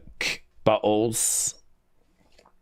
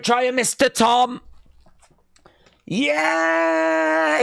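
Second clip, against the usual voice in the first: ecstatic celebration; 11 semitones higher than usual. Real, not acted. Wrestling fan screaming for Sammy's title win, ecstatic. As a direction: yell it like your guy just won the belt.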